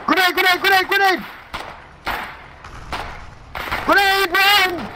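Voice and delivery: grenade warning yell. Real, not acted. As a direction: yell the warning, slowing on "run".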